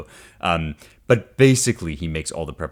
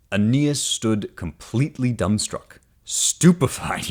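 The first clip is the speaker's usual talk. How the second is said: slow, dramatic reading of the verse; poem reading; 5 semitones higher, slower than usual. Acted. Teacher reading Virgil to the class, slow and dramatic. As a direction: let each word of the epic land.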